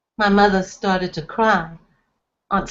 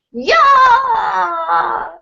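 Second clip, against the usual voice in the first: high loud shout of effort; a big yell as he flings it; Izzy hurling the latke with a yelp; throwing yell; 11 semitones higher, 5 dB louder than usual. A narrator's reading.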